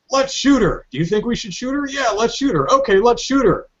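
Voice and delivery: high-pitched, eager mock squad; bickering guards. Guards agreeing among themselves to fire, hammed-up eagerness. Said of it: "And it's like".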